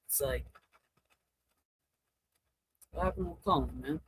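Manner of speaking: ordinary talk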